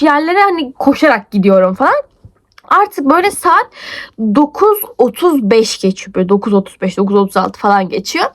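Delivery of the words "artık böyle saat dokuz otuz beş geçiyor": usual speaking voice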